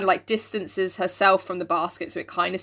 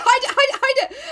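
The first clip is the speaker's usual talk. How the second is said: high, loud, frantic repetition; mock panic; 4 dB louder than usual. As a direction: squeal it like guests are at the door. Acted panic, hiding the food.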